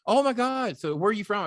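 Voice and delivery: high, loud, delighted exclamation; retelling an excited rich guy on the phone; excited Cuban. Retold or imitated. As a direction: gush like a thrilled billionaire.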